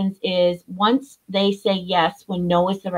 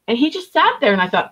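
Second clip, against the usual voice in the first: normal talking voice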